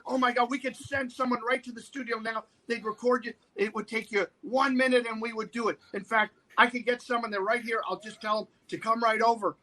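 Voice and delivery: high, gushing eagerness to please; acted breathless-to-oblige producer; eager fawning. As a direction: bend over backwards for the star. Producer fawning over the supposed star.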